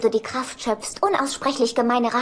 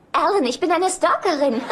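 ordinary delivery

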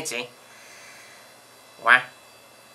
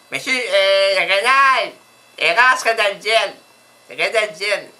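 loud, raised phone voice; insistent name-giving; 4 semitones higher, 6 dB louder, slower than usual. Acted. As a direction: call the name out higher and louder to be understood. Répète son nom, mal compris. (nom répété fort et haut, avec insistance)

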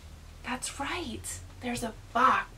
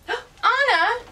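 sudden delighted gasp, high and loud; surprised gasp; 14 semitones higher, 9 dB louder than usual. Real, not acted. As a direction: gasp as if spotting a treasure. Gasps at finding megaphone.

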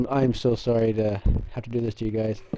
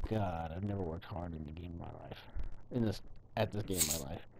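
much softer, lower, worn-out murmur; weary murmur; 5 semitones lower, 11 dB quieter than usual. Real, not acted. Exhausted gamer telling viewers how hard he worked, low murmur. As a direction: sigh it out after four hours of searching. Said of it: "so pissed off right now".

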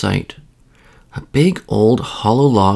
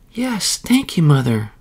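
put-on high little child's voice; 6 semitones higher than usual. A narrator's reading. Waking child thanks mother bear, high child voice. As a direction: lift it high like a small child. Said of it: "said the child".

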